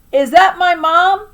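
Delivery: put-on high, loud child's call, drawn out; child yelling. A narrator's reading. Boy yelling out to grandma, high and loud. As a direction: shout hopefully from the window. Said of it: "So I yelled out to Grandma".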